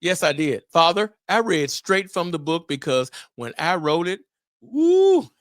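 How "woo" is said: high, loud woo; a burst of feeling; excited woo. Real, not acted. Host remembers writing the book, fired up. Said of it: "I was in a zone".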